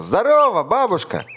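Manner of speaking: very high, loud hearty hello; cheery mouse greeting. A narrator's reading. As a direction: hail granny owl with glee. Mouse hails owl.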